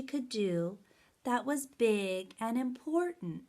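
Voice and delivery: lively, expressive picture-book reading; reading to children. Acted. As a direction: read aloud with big storytelling energy. Reads story to children.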